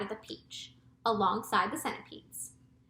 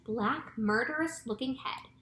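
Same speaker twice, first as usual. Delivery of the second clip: plain reading voice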